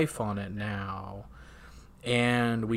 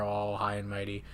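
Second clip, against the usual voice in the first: ordinary talk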